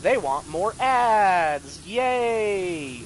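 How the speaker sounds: drawn-out mock celebration; slow mock-cheerful whoop; sarcastic cheer. Real, not acted. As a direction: stretch it into a fake, sarcastic hooray. Sarcastic cheer about more ads.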